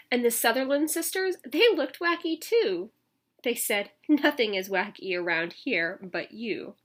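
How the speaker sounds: lively storybook read-aloud; reading to children. A narrator's reading. Teacher reading a picture book to children, lively. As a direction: read it out to the kids with bounce.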